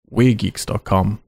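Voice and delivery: slow, words spaced apart; slow with gaps. Shown as a demonstration. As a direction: leave a gap between words. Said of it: "Leaving the gap there now".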